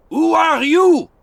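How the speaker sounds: high, loud, slow shout; shouted challenge. A narrator's reading. French sentry shouting at strangers, loud and slow. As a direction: bellow it into the fog. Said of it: "shouted the Frenchman".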